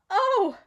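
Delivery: sharp high gasp of surprise; a loud shocked gasp. A narrator's reading. Beatrice gasping at the sight of Clovis, high and loud. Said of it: "gasped Beatrice".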